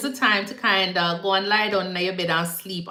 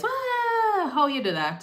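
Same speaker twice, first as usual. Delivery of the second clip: surprised exclaim; 13 semitones higher than usual. Real, not acted. Reacting to a TikTok sound.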